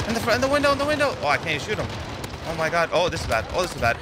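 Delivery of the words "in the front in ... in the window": urgent callouts. Real, not acted. Urgent orders to teammates.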